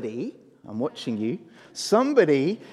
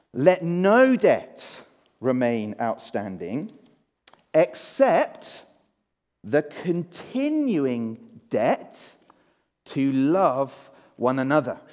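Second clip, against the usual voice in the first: slow, solemn reading of the verse; scripture reading; 5 semitones lower, much slower than usual. Shown as a demonstration. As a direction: read it slowly and weightily. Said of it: "Have a look in your Bibles".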